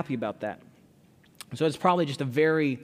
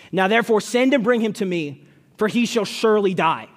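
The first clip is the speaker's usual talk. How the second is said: raised, higher voice in indignant rebuke; angry king; 5 semitones higher, 6 dB louder than usual. A narrator's reading. Preacher reads Saul's rebuke of his son Jonathan, angrily. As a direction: read it out loud and forceful, as an angry accusation. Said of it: "Saul's anger was aroused against Jonathan".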